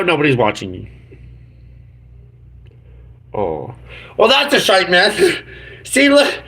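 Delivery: ordinary talk, nothing marked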